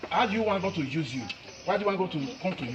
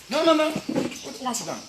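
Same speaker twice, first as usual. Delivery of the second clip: sharp protest; 8 semitones higher, much slower than usual. Real, not acted. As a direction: cut them off fast and high. Stops child sitting down.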